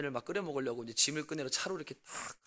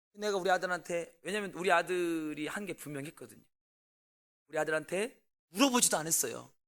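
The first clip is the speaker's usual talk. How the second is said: ordinary talk, nothing marked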